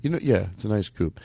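ordinary talk, nothing marked

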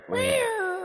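squeaky meowing "Where?" way up high; cat voice. Acted. Cat talking through Rory, squeaky meow. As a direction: mew the word "Where?" like a cat. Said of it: "a cat is actually trying to talk through Rory".

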